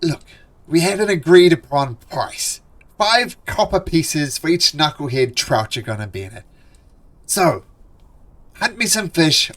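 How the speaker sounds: loud, insistent boss dwarf; pushy dwarf haggling. Acted. Dwarf foreman presses the fishers about the price. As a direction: lean on them to take the deal.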